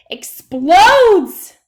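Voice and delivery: very loud, very slow blow-up; exploding. A narrator's reading. Narrating the girl's temper blowing up after she hurts her finger, in a loud slow burst. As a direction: let the words burst out like a tantrum.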